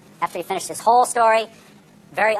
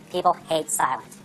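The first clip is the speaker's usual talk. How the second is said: ordinary delivery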